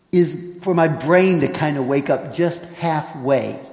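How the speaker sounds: ordinary talk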